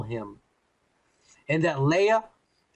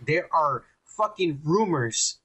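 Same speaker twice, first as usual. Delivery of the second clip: ordinary talk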